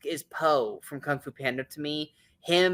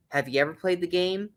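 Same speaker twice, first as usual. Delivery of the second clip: normal talking voice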